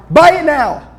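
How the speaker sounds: high, loud, punchy order; urgent command. Real, not acted. Speaker urging the crowd to buy now, loud commanding shout. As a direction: bark it like a final push.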